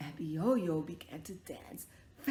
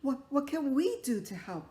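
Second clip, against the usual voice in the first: put-on a light, higher eager child voice; little boy voice; 5 semitones higher, 5 dB louder than usual. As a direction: ask like an eager small boy. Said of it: "And the little boy said".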